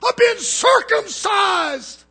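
high, slow, shrieking mock yell; heckling woman. Preacher imitating a woman yelling in the service. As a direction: ham up the heckler's outraged cry. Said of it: "She says".

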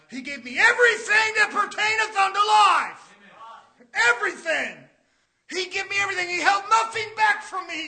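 fired-up sermon delivery; forceful preaching. Real, not acted. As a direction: exhort the congregation loudly.

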